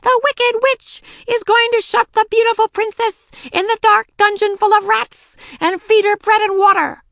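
very high, loud witchy proclamation; crying wicked witch. A narrator's reading. Daisy as witch shutting princess away, high loud cry. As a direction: proclaim the threat at a high pitch. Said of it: "cried Daisy".

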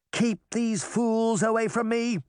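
high, urgent order from Pinocchio; bossy high-pitched boy's command. A narrator's reading. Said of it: "Pinocchio ordered".

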